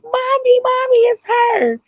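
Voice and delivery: put-on very high child voice calling out; squealy mock child excitement; excited little girl. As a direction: imitate a kid calling for mom. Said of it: "she ran away and was like".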